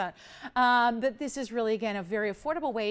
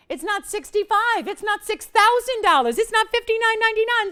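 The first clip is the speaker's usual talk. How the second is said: high, loud, punchy price countdown; much higher and louder, each line hit hard; excited sales pitch; 12 semitones higher, 9 dB louder than usual. Real, not acted. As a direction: hammer each price away with rising thrill. Host listing prices it beats.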